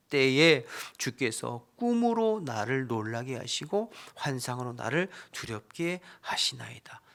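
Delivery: dramatic lament reading of Job; loud, earnest scripture reading. Acted. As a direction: read the verse as Job's anguished plea. Reads Job's lament aloud.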